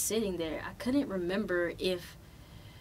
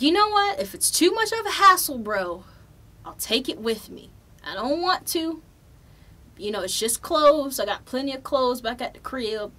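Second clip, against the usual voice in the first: much higher and louder, fired up; loud, high-pitched exasperated rant at the gate; heated argument; 6 semitones higher, 8 dB louder than usual. Retold or imitated. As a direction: confront the agent, voice raised high. Arguing with airline agent.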